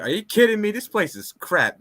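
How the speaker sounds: lightly voiced Johnny scoffing at the place; Johnny complaining. Johnny scoffs at the overpriced dojo space, mildly voiced. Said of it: "Johnny's like".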